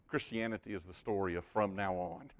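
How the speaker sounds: ordinary talk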